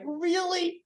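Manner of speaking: squeaky high disbelieving really; incredulous really. Retold or imitated. Telling the host how he reacted to Redd Foxx, squeaky incredulous. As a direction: pitch it way up in disbelief.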